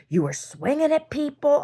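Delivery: high, loud mocking voice; mocking dad's accusation. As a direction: sneer his accusation back. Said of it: "he's telling me".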